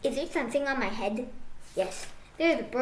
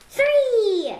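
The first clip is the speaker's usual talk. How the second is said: much higher and louder burst on three; loud reveal; 9 semitones higher, 8 dB louder than usual. Acted. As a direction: land the last count like a pop. Chicks hatching countdown.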